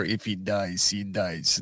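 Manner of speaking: low, quiet, deadpan Russian boxer; quoting Rocky Four in a low, quiet Russian drone; Drago impression. Quoting the Rocky Four line, low and quiet Drago voice. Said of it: "that line from Rocky Four".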